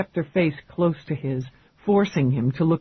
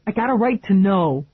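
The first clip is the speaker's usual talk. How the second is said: louder, pressing the point; insisting; 5 semitones higher, 6 dB louder than usual. A narrator's reading. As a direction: push back, won't take no. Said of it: "he insisted".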